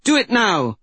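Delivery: high-pitched urgent order; urgent command. A narrator's reading. Mystic ordering old woman as bear charges, urgent cry. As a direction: push it out high, insisting on action.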